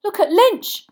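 high, loud urgent call; yelling. A narrator's reading. Boy spots the seeker diving, excited yell. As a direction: yell to point out the action. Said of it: "Harry yelled".

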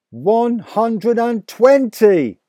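high, loud, slow triumphant cry; father counting the record haul; cried out. A narrator's reading. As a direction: crow out the number. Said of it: "he cried".

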